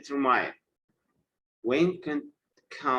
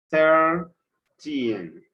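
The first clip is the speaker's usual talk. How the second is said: put-on "Thirteen" with THIR loud, high and long, teen falling; first-syllable stress; 5 semitones higher, 7 dB louder than usual. Shown as a demonstration. Teacher demonstrating stress, "Thirteen" split with THIR hammered. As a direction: punch THIR in "Thirteen", pause, then teen. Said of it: "stress will be in the first syllable".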